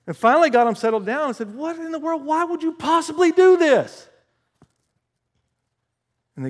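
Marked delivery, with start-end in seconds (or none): 1.5-4.1 high-pitched, incredulous questioning; exasperated demand. Retold or imitated. Preacher retells questioning two men who fought, high exasperated demand.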